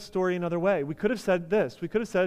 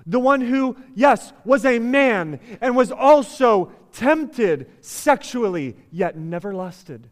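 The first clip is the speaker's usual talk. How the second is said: loud, raised, driving sermon delivery; impassioned preaching; 7 semitones higher, 10 dB louder than usual. Real, not acted. Preacher applying the iPad story to grace, impassioned preaching. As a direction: build urgent conviction for the congregation.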